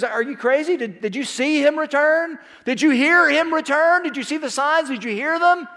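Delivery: emphatic disbelief. Retold or imitated. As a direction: fire off challenging questions, imitating an exasperated teacher. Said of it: "he's emphatic concerning the question".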